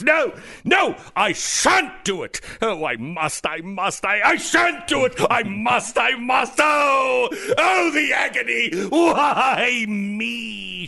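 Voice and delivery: overacted anguish, shrieking high; mock anguished crying out. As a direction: melodramatic mock lament, loud and high. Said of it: "she cried out in her chambers".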